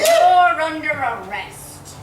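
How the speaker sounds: loud, slow official arrest; stern guard. Acted. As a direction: lay down the arrest firmly. Arrest exchange in character.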